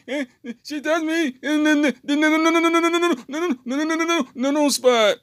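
put-on child crying out to his mother, very high and loud; high wailing child. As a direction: wail it like a kid tattling, stretching every no. Boy tattles to mom.

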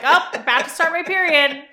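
loud, high-pitched mock alarm; sudden realisation. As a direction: act out a gasping, loud realisation. Acts out her sudden realisation.

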